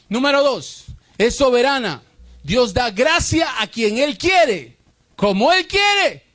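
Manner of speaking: slow, loud and high preaching; loud drawn-out preaching; preaching shout. Acted. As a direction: proclaim it at full force. Preaching climax over music.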